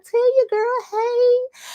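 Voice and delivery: high, slow, singsong hey; excited greeting. Real, not acted. Calling hello to her viewers, high and singsong. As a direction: sing it out, high and drawn out.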